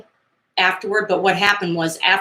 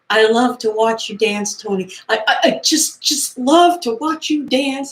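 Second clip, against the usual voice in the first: put-on starstruck girl; 5 semitones higher than usual. Voicing the starstruck girl gushing to Tony. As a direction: play a dazzled fan gushing shyly. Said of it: "she goes".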